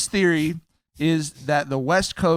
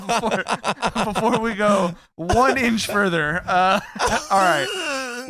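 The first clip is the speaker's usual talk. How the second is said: laughing; 5 semitones higher, slower than usual. Real, not acted. Laughing while segueing.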